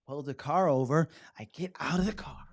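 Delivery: acted out. Shown as a demonstration. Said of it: "Act it out".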